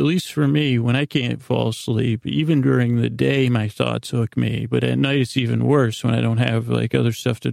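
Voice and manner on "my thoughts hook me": ordinary talk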